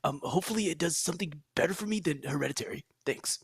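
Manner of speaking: a hint of a movie trailer announcer. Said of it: "that's my movie guy voice".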